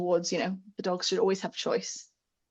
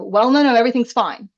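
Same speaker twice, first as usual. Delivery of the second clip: brushing off feelings; 3 semitones higher, 9 dB louder than usual. Pretend. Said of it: "come in above me and go".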